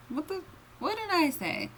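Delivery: surprised. Real, not acted. Flustered at her reading.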